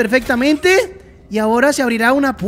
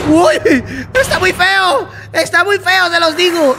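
shocked exclaiming; 4 semitones higher, slower than usual. Real, not acted. Telling viewers the monster is ugly, shocked shout. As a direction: yell how ugly it is.

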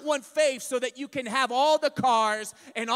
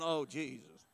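mock-weary saint; 9 semitones lower, 10 dB quieter than usual. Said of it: "looking down going".

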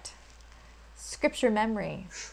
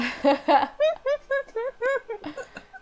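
high burst of laughter; 5 dB louder than usual. Real, not acted. Reciting verse, breaks into laughter.

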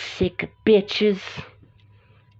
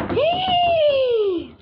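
high squeal. Real, not acted. Upset driver at a closed road, high squeal. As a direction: squeal out in real distress.

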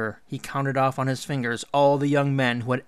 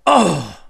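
loud, high gasping roar; enraged roar; 6 semitones higher, 12 dB louder than usual. A narrator's reading. Ishtar beating her fists on the walls, enraged roar. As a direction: explode in breathy fury. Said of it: "in an ecstasy of rage".

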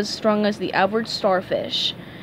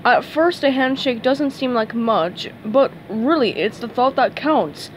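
ordinary delivery, nothing marked

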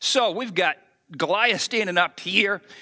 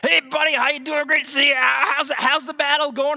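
bright, raised, friendly hollering; cheery brother greeting; 6 semitones higher, 3 dB louder than usual. Retold or imitated. A brother hails David with a loud cheery greeting. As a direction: call out like glad to see him.

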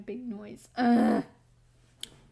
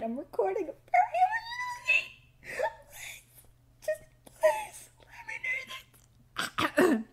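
very high, squeaky whine; mock-crying plea. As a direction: beg in a tiny tearful squeal. Begging Misty for quiet while recording.

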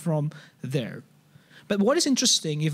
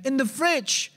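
wife voice; 7 semitones higher than usual. Retold or imitated. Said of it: "And then your wife said".

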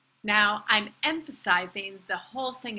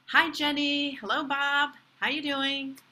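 higher, friendly hellos by name; friendly greeting; 5 semitones higher than usual. Shown as a demonstration. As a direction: greet people you know, pitched up. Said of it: "When you say hi or hello".

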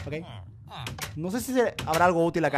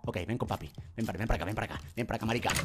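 low taunt; 10 semitones lower, 12 dB quieter, faster than usual. Real, not acted. Taunting enemy quietly.

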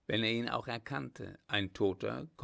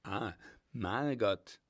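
slow, drawn-out surprise; lingering note of recognition and surprise; surprised; slower than usual. A narrator's reading. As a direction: react with mild astonishment at who it is. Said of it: "antwortete er überrascht".

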